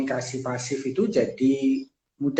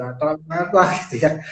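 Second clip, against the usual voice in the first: ordinary talk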